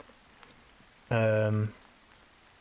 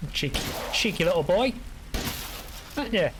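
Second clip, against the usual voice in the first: put-on baby talk; 10 semitones higher, faster than usual. Teasing game enemy.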